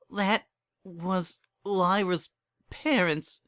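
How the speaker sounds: high, slow, sobbing words; a crying mare tells her friend who came. Acted. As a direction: sob it out into her friend's mane. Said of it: "sobbed Bon Bon".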